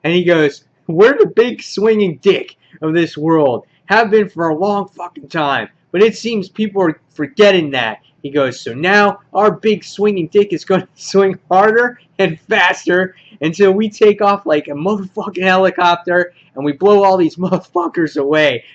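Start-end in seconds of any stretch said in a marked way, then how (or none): 0.9-8.0 lightly acted gang leader's rant; louder, higher, a hammed-up speech to his men; Negan. Negan talking to his guys at the end, lightly acted rant. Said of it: "He's talking to his guys here, and he goes". 8.6-18.6 lightly acted gang leader's rant; louder, higher, a hammed-up speech to his men; Negan. Negan talking to his guys at the end, lightly acted rant. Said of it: "He's talking to his guys here, and he goes".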